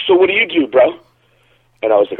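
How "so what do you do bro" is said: high, challenging bro voice; imitating the cocky strongman. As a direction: toss the question like a drunk show-off. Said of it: "he was like".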